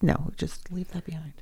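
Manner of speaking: breathy whisper. Real, not acted. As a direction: whisper it softly. Hushed aside.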